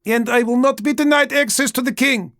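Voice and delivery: queen shouting through a closed door; indignant queen. Acted. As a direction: insist on your royal rank. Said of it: "came her familiar voice".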